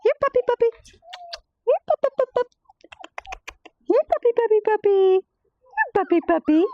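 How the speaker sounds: high sing-song here puppy; calling puppies. Real, not acted. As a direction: call them in, high and bright.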